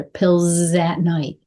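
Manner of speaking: "Pills at night" slow, long z into "at"; linked words. Shown as a demonstration. Coach showing how words stay together, linked words. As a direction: run "Pills at night" together as one drawn-out word. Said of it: "They stay together".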